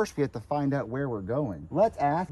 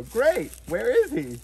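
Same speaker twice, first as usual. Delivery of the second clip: high, loud, slow question; excited show host; 6 semitones higher, 6 dB louder, slower than usual. Shown as a demonstration. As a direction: draw it out for a young audience. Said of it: "say, go, go".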